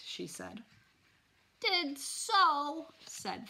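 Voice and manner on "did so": put-on high, loud little-kid retort; loud childish comeback; defiant toddler. A narrator's reading. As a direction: insist like a small child. Toddler contradicts his mother.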